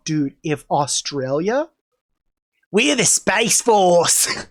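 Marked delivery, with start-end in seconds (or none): 2.7-4.5 slow, drawn-out mock Australian; Australian accent. Australian space force introducing itself, mock Australian accent. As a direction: put on a broad Aussie accent. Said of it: "My, my Australian accent".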